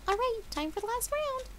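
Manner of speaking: high chirpy voice; Pinkie. A narrator's reading. Pinkie telling Dash the last round, high chirpy. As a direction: announce it cheerfully.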